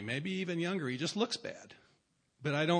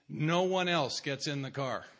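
usual speaking voice

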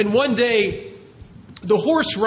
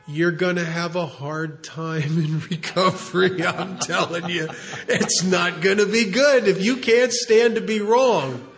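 laughing. Real, not acted. Laughs while warning.

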